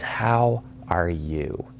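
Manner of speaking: "How are you" drawn out very slowly, sinking low; descending tone. Shown as a demonstration. Caller asks the key question on the phone, descending tone. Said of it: "done in a descending tone of voice".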